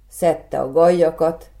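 ordinary delivery, nothing marked

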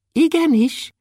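very high, tiny dwarf voice; thin voice. A narrator's reading. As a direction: answer in a thin, high pipe. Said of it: "mondta Kökölyszi vékonyan". (vékony, magas törpehang)

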